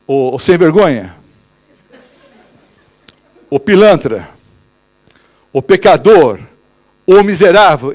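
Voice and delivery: a touch of a stern accuser; accusing voice. Acted. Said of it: "essa voz de quem?". (voz acusadora, mais alta e severa)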